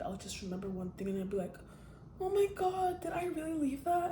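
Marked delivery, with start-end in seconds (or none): 2.2-4.1 high, startled squeal of dismay; dismayed gasp. Retold or imitated. Remembering a forgotten keepsake, squeaky high panic. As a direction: suddenly realize you left something behind. Imagined panic over left-behind things.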